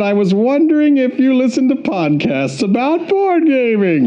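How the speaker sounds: high, hooting goofy falsetto; exaggerated high joke voice. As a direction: swoop it up high as a silly character. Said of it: "I've been doing goofy voices".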